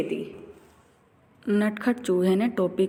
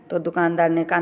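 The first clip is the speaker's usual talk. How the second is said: ordinary delivery, nothing marked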